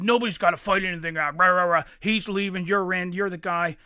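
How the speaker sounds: loud mock tough-guy delivery; imitating a loudmouth. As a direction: lay it down like a belligerent big guy. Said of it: "He said".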